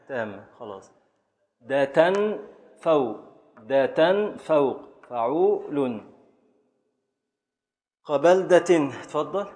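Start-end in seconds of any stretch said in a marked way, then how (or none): 1.6-6.1 ده تنفوق drawn out, syllable by syllable; slow scansion. Shown as a demonstration. Teacher matching the verse to فعول for students, slow and deliberate. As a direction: spell out the metrical foot slowly. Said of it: "فعول تامة يعني".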